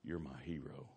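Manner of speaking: low, hushed but voiced murmur; soft, low stand-in for a whisper; retold whisper. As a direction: confide it softly, imitating brother. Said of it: "he whispered in my ear".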